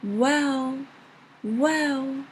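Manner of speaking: "Well" drawn out with a bending pitch; third-tone well. Shown as a demonstration. Teacher likening third tone to English, drawn-out bending "Well". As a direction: stretch "Well" and let its tune bend. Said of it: "The third one is".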